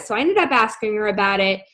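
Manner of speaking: ordinary delivery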